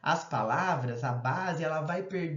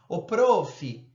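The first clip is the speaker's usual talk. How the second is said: loud, friendly call to the teacher; student calling out to the teacher, loud call; 2 semitones higher, 6 dB louder than usual. Shown as a demonstration. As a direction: call out to the teacher across the room.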